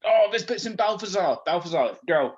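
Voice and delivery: normal talking voice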